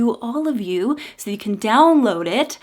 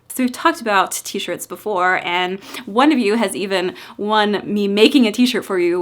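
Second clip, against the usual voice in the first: ordinary delivery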